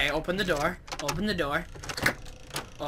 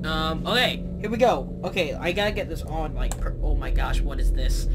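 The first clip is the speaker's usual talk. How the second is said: normal talking voice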